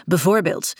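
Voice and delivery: ordinary delivery, nothing marked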